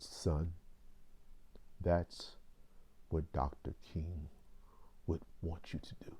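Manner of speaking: lightly imitating a father's authoritative bass; smooth, authoritative bass. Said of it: "in his very smooth, authoritative bass voice".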